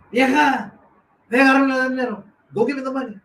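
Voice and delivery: mock yell at the wife, pitched high; retold yell. Man sends his old lady for the money, yelling. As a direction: call out loudly and high, imitating a husband's order. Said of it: "yells at his old lady".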